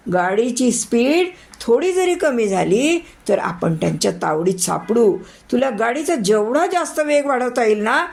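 high, tense, pressing commands; urgent orders. Acted. Boss urges driver to speed past robbers, tense high urging.

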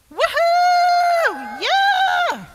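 very high, loud whoop; rejoicing cheer. Pretend. Storyteller has children rejoice, loud cheer. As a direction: whoop it up in celebration. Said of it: "Let me hear you rejoice".

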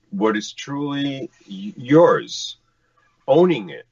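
normal talking voice

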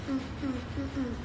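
usual speaking voice

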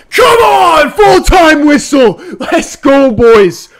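real shout. Real, not acted. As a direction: cheer the final whistle. Fan at full-time whistle.

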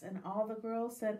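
ordinary delivery, nothing marked

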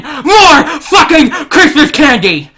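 loud, high, slow cheer; excited shout. Real, not acted. Opening Christmas gifts on stream, a loud cheer. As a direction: celebrate the gift at full volume. Cheering over another candy gift.

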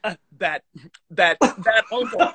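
slow, laughing through the words. Real, not acted. Guest repeats host's uncle remark, laughing. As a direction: savour the joke while chuckling.

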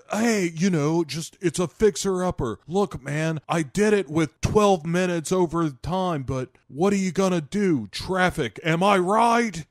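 breezy salesman voice. Retold or imitated. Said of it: "Nicolas Cage is like".

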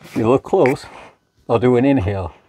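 muffled behind a respirator; speaking inside a sealed mask; through a gas mask. Real, not acted. Seen 0: Full-face gas mask worn over mouth throughout; reference frames unmasked.

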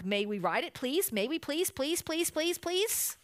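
high-pitched pleading, climbing as it repeats; childish begging. A narrator's reading. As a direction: beg like an eager little cub. Cubs begging for a ride.

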